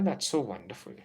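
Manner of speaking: normal talking voice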